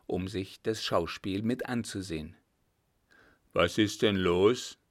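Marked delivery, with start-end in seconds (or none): 3.5-4.8 an unhurried coachman asking, lightly voiced; leisurely coachman. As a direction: ask it lazily, in no hurry. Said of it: "fragte er gemächlich".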